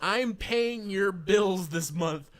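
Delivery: very slow, high, loud pleading; mock pleading. Begging the lineman for power, slow and hammed-up. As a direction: drag it out like a begging customer.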